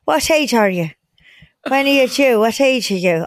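high Irish voice easing down; Irish accent. Retold or imitated. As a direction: keep the Irish lilt going. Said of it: "in an Irish accent".

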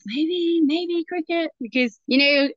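a lifted, hopeful "maybe cricket"; eager suggestion. Retold or imitated. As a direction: pitch it brightly to the girls. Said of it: "I was like".

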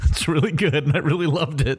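high-pitched, talking through a laugh. Real, not acted. Co-host recalls a silly Oreo treatment, really laughing. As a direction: praise it while laughing.

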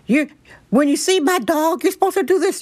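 high, shrill-pitched mock yell of a rude stranger; imitating yelling woman. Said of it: "she started yelling".

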